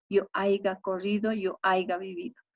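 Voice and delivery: "haiga" with a g sound inserted; nonstandard "haiga". Shown as a demonstration. Teacher citing how many people misconjugate, nonstandard "haiga". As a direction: sound out "haiga" as AI-ga, not "haya". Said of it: "lo cual no es correcto".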